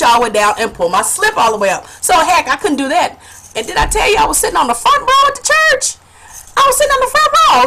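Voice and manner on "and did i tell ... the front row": high, fast, incredulous squeal; comic outraged emphasis. Real, not acted. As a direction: pitch it way up for the punchline. Comic disbelief at where she sat.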